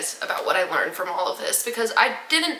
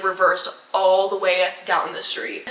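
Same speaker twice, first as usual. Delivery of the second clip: ordinary delivery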